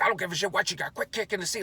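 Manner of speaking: high, worked-up complaint in a put-on voice; acted angry passenger. Irate passenger to the man behind kicking his seat, high mock anger.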